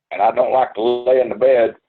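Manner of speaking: normal talking voice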